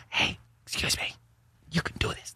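soft, hushed stage whisper; imitated whisper. Stranger waking someone with a word in the ear, whispering. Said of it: "like, whispering in your ear".

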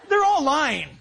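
talking through real laughter; laughing. Real, not acted. Preacher cracking up over his own joke about lying newsmen, laughing. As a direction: break up laughing mid-sentence, then finish.

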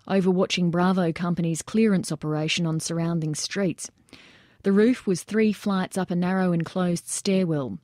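usual speaking voice

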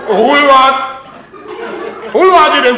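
loud, high insult; exasperated shouting; worked-up outrage. Acted. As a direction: burst out indignantly at the neighbour. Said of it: "Já se vždycky tak rozčílím, když tohleto vyprávím".

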